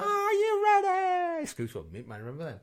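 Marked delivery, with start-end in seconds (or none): 0.0-1.5 high, long drawn-out rave shout. Retold or imitated. Asked about the techno band, imitating the rave shout. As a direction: hype up the crowd like a techno frontman. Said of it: "What about [name]?".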